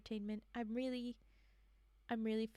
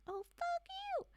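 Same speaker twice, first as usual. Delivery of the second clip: much higher indignant yell at the character; high outraged outburst; slower than usual. Real, not acted. Reader outraged at Burns calling Marley irrational, high outburst. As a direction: snap back in outrage.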